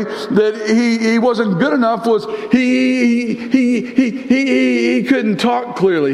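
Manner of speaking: high, driving preacher's voice; building sermon intensity; preaching at full force. Acted. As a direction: drive the story home from the pulpit.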